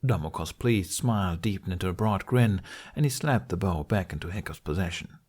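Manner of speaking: usual speaking voice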